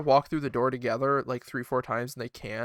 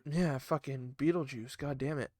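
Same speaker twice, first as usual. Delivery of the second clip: grumbling aside; 3 semitones lower, 12 dB quieter than usual. Real, not acted.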